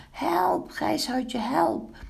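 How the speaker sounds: very high, panicked storybook cries; shrieking. A narrator's reading. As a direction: call each friend in high alarm. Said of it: "gilde Zwart Snoetje". (hoog en paniekerig gillend)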